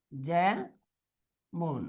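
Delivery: যেমন low and soft, as "jæ ... mon"; syllable by syllable. Shown as a demonstration. Teacher dictating the answer, syllable by syllable. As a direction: pause long between the two syllables of যেমন.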